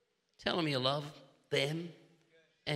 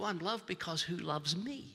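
usual speaking voice

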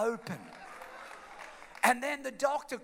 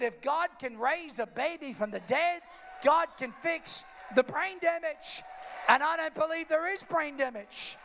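a higher, louder preacher's shout; shouted conviction; 6 semitones higher, 3 dB louder than usual. Real, not acted. Preacher insists God can heal, higher louder shout. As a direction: shout the faith home to the crowd.

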